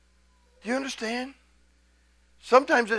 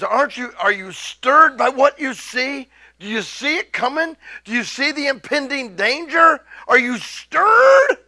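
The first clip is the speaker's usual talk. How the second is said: preaching at full force; 6 semitones higher, 5 dB louder than usual. Acted. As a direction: press them until you shout stirred. Prophet's challenge to hearers.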